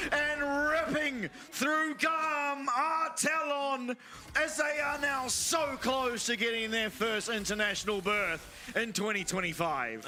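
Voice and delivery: slow, building hype call; esports caster. Acted. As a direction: drag out the names, rising excitement. Caster calls the game-ending push.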